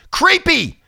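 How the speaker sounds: "Creepy" thrown out high and sharp; creepy exclaimed. Shown as a demonstration. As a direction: fling "Creepy" up high in disgust.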